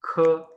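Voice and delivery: ख rasped from the throat; throaty kh. Shown as a demonstration. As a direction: scrape ख at the back of the throat. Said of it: "pronounce करते हैं ख से".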